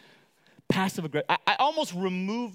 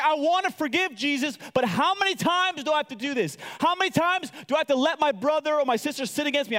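high, pleading complaint as acted Peter; exasperated questioning; 7 semitones higher than usual. Peter asking Jesus how often he must forgive, exasperated.

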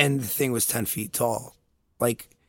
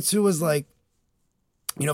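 ordinary talk

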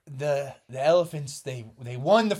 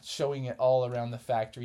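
ordinary delivery, nothing marked

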